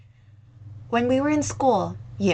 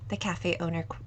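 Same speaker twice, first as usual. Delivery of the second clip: plain reading voice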